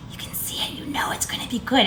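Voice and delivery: hushed conspiratorial whisper. Real, not acted. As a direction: lean in and whisper. Whispering to viewers.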